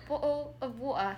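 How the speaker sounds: British glottal stops in "bottle of water"; British accent. Acted. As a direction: drop the t's like a mock Brit. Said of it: "I have to be British".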